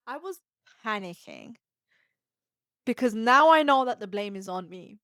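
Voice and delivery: ordinary delivery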